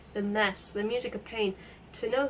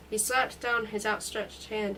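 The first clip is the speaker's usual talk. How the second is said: usual speaking voice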